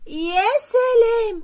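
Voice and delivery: a high, drawn-out mocking "يا سلام"; sarcastic scoff. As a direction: scoff at him slowly, mocking. Wife scoffing at husband. ("يا سلام" بسخرية ممطوطة وعالية)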